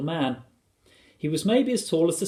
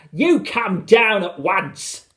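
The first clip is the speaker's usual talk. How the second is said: loud, high, slow motherly command; raised-voice scolding, each word drawn out; stern order; 7 semitones higher, 7 dB louder, much slower than usual. A narrator's reading. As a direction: call up to them firmly. Mother ordering flying sons down.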